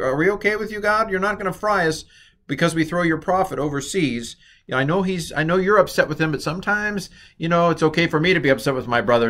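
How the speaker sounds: high-pitched, anxious mock pleading; praying sailors. Sailors asking God before throwing Jonah overboard, hammed-up nervous plea. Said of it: "they're praying".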